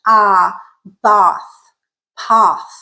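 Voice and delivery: "bath, path" with a long British ah. Shown as a demonstration. Teacher showing British English vowel, long British ah. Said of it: "typically in British English".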